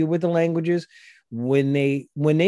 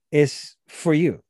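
ordinary talk, nothing marked

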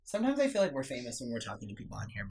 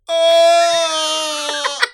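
long, high, loud moaning Oh; imitated groan; 14 dB louder than usual. Granddaughter mimicking grandpa's loud moan. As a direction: moan it out like a dying man. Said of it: "But I was hearing".

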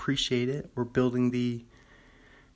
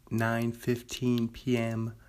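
ordinary talk, nothing marked

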